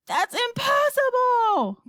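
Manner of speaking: loud, high, drawn-out protest; crying out. A narrator's reading. Astronaut refusing the president's order, crying out. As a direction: wail it out in dismay. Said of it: "cried Showler".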